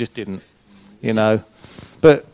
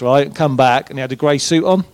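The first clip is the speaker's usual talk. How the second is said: normal talking voice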